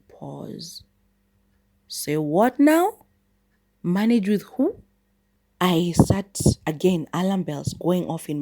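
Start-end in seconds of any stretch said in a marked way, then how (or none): 0.1-4.8 higher and louder, slowly stunned; incredulous. Retold or imitated. Hearing she must share with a man, incredulous. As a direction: say what now, drawn out in disbelief. Shocked at stranger's words.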